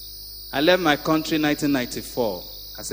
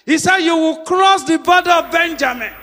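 higher, loud preaching cadence; 12 semitones higher, 7 dB louder than usual. Acted. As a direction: preach it out to the congregation. Preacher at full force.

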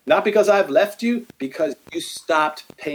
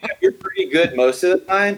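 laughing mock rebuke; 3 semitones higher than usual. Mocking an imagined Jesus rebuke.

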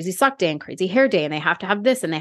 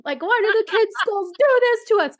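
much higher, ranting mock meltdown over "freaking"; losing it; 11 semitones higher than usual. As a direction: shriek it like a mom at her wits' end. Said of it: "finally losing my crap".

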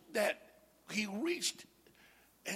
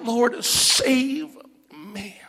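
loud, drawn-out plea, higher pitched; crying out; 4 semitones higher, 11 dB louder, much slower than usual. Acted. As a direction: stretch each word of the cry for help. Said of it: "cried out".

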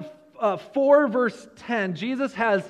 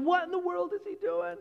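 higher-voiced mock grumble among the disciples; lightly voiced, higher, disapproving disciples; grumbling disciples; 8 semitones higher than usual. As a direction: play the disciples' suspicious inner voices. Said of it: "their minds are going".

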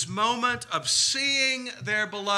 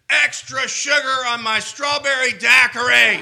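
put-on drunk slurring; 6 semitones higher, 6 dB louder than usual. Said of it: "turned to the bartender and said".

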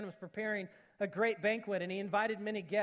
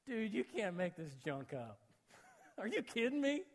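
laughing disbelief; 5 dB quieter than usual. Retold or imitated.

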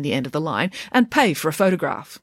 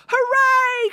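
cheering; 3 dB louder than usual. A narrator's reading. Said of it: "cried the crowd".

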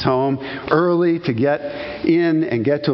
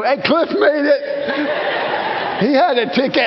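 joking cheer; 10 semitones higher than usual. Pretend. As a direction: celebrate a friend made it. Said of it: "you're gonna know".

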